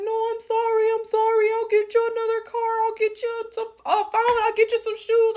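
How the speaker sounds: high, whimpering mock sobs; imitated crying apology. Ex-boyfriend crying apologies after stranding her, mock sobbing. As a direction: play him pleading through tears. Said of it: "and he's crying".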